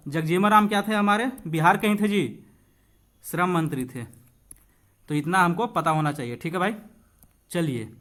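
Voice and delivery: ordinary delivery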